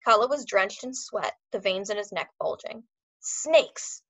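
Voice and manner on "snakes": spat out. A narrator's reading. Dog trainer spitting the word at the foreman, harsh hiss. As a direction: spit the word out in a harsh whisper. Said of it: "He spit out the word".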